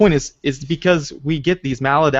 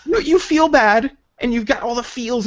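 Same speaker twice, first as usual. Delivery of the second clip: high, slow, put-on whiny voice; mock whiny voice; 9 semitones higher than usual. Host mocks an adult who feels hurt with a whiny voice. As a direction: pout through it like a sulking kid. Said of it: "and you're like".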